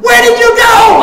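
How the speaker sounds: high, loud alarmed demand; family yelling. Retold or imitated. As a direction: shout for an answer. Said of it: "my mother and my sister".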